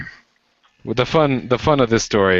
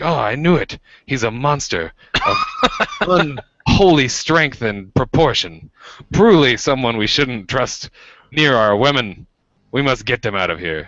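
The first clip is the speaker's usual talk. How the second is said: mock dramatic accuser; 6 semitones higher than usual. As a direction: announce it like a hammy knight. Mock dramatic speech of guy.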